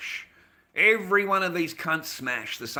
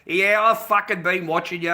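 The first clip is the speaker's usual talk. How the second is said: louder imitated Irish lad; hint of an Irish brogue; Irish accent; 3 semitones higher, 7 dB louder than usual. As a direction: confront them in a put-on Irish accent. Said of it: "I've got in that Irish, uh, uh, thing".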